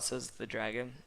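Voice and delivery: usual speaking voice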